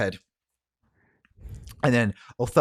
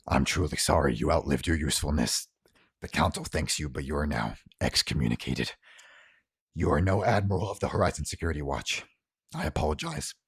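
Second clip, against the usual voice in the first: lower, measured pronouncement; lightly voiced cold official, pitched lower; formal verdict; 5 semitones lower than usual. Baraka dismisses kneeling admiral, measured formal voice. Villain passes formal sentence.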